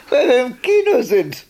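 laughing through the answer, pitch up. Real, not acted. Wife laughs while telling old husband they're at the cinema. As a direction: chuckle it out fondly.

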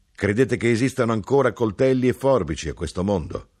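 mocking knife-grinder. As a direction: sneer at the idea. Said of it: "di intonazione beffarda".

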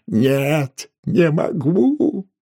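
high, drawn-out tearful wail; sobbing. A narrator's reading. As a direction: wail that you cannot bear it. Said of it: "подавленные рыдания столяра". (протяжно, с рыданием)

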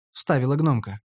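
usual speaking voice

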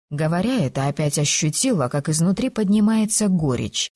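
usual speaking voice

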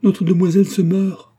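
high, loud terrified cry; panicked shout. A narrator's reading. Old nurse cries out to the whole house, terrified. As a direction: call the house in alarm. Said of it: "cria la Nia Nia".

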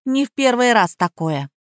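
usual speaking voice

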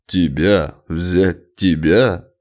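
loud, low, slow incredulous sneer; scornful disbelief; nasty mockery. A narrator's reading. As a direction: draw it out with contempt. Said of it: "противно рассмеялся".

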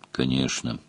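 low, soft, toneless demon; emotionless demon voice. A narrator's reading. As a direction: answer with no feeling at all. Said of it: "бесстрастно произнёс демон".

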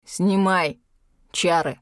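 measured, heavy order through contained fury; angry, restrained command. A narrator's reading. Furious dragon orders heroine to lift spell, restrained anger. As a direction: order it firmly, word by word. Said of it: "Арьек яростно дышал".

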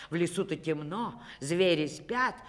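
usual speaking voice

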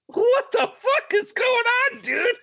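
very high-pitched, incredulous squeal; stunned disbelief. Retold or imitated. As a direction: react like you can't believe what you're hearing. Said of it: "and I'm just like".

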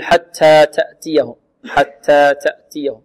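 overdone puff on every T, nearly an S; exaggerated breathy T. Shown as a demonstration. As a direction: overdo the release in حتى تأتيهم until the T sounds like ts. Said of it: "أو نبالغ في الهمس".

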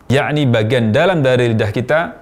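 ordinary delivery

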